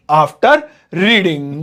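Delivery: after and reading split by a pause, slow; word by word. Shown as a demonstration. Teacher splitting after reading for students, slowly. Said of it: "इसे बनाएंगे पढ़ पढ़कर".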